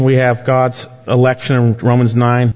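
usual speaking voice

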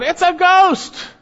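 high, loud drawn-out cry; a frightened crowd's shout, acted from the pulpit; yelled out. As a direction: yell it out in alarm, stretched long. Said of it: "They're yelling it out".

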